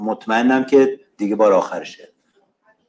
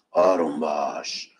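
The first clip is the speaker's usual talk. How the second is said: آروم باش dropped low and soft, almost breathed; soothing hush; 11 semitones lower, 7 dB quieter than usual. Shown as a demonstration. Teacher hushing a fidgety student, low and soft. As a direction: settle the class with a hushed آروم باش.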